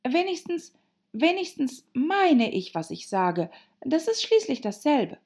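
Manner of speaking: put-on high-pitched girl's protest; Alice, defensive. Alice justifying herself to the March Hare, high and defensive.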